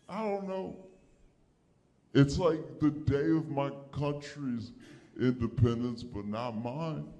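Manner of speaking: usual speaking voice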